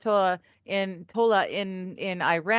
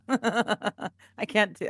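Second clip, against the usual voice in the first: normal talking voice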